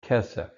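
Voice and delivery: Kesef with KE stressed, falling after; accent the "Ke" of "Kesef"; "Kesef" leaning on its first syllable. Shown as a demonstration. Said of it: "accent on the first syllable".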